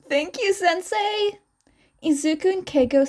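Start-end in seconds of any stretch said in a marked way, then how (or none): 0.1-1.4 bright, higher thank-you; grateful thanks. Acted. Two students thanking their teacher, pitched up. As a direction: thank him eagerly.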